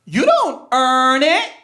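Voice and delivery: hammed-up movie outburst, words drawn out; Sister Act monologue. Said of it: "grab, like, whatever monologue from Sister Act".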